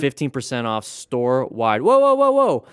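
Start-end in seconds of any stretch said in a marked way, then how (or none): 1.8-2.7 high, loud alarmed whoas; sudden loud alarm; startled whoa. Real, not acted. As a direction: react as the car cuts in. Reacting to a sudden hazard.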